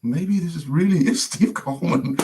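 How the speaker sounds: high, wondering voice; re-enacted stunned realisation, breaking into a laugh; dawning realisation. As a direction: let amazed disbelief creep in. Dawning realisation the caller was real.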